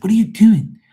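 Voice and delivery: high, loud retold scolding; exasperated yell. Son to mom who almost dropped potatoes, exasperated yell. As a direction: yelp it at her, pitch leaping up.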